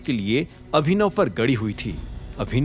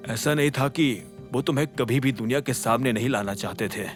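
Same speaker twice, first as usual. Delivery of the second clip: low, soft, sorrowful dialogue sinking lower; subdued and weighed down, fading at the end; voice growing heavy; 5 semitones lower, 6 dB quieter than usual. A narrator's reading. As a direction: let the voice grow heavy with regret. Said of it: "अकरम की आवाज भारी हो गई".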